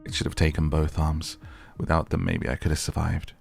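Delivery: ordinary delivery, nothing marked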